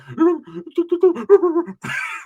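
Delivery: high, puppyish giggle; cousin's man giggling when checked. Retold or imitated. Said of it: "he'd be like".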